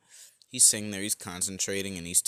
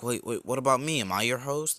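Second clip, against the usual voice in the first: higher, louder burst of mild panic; acted fluster, pitched up and louder; freaking out; 5 semitones higher, 6 dB louder than usual. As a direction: blurt it out, voice raised in alarm. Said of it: "As Izuku starts freaking out".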